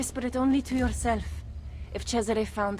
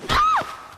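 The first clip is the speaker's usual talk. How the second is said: sharp cry of effort; 5 dB louder than usual. Acted. Strikes the prisoner.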